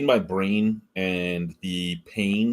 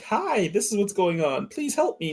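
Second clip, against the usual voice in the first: high, slow whiny plea; mocking a timid patient asking the doctor for help; mock pleading; 12 semitones higher than usual. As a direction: play the helpless patient, pitch climbing. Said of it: "be like".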